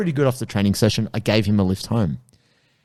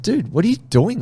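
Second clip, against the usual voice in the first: exasperated; 10 semitones higher than usual. Retold or imitated. Telling off a student retold.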